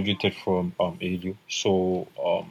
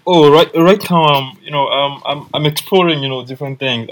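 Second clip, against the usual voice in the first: excited; 6 semitones higher, 10 dB louder than usual. Real, not acted. Excited answer, much louder.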